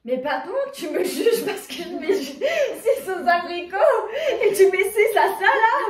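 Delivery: loud, laughing indignation; outraged but giggling; laughing protest. Real, not acted. Protesting a friend's rating.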